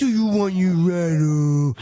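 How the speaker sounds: put-on slow, cooing baby talk. Shown as a demonstration. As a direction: coo it to a baby. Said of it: "You talk to the baby in a baby voice".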